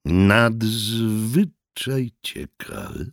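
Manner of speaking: very slow, stretched-out marvelling; drawn-out exclamation. A narrator's reading. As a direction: savour every syllable in wonder. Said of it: "zawołał cudzoziemiec".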